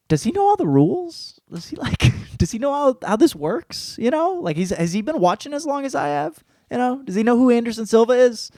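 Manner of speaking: high-pitched, disbelieving questions; incredulous questioning. Retold or imitated. Fan doubting a young champion to himself, high and incredulous.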